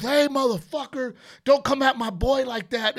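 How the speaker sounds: loud, high tough-guy shout; a friend yelling to defend his boy; Marine-style yell. Retold or imitated. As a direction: charge in defending your buddy. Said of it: "going all Marine style".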